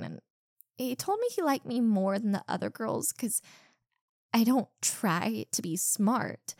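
usual speaking voice

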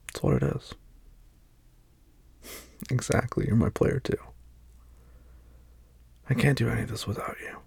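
ordinary talk

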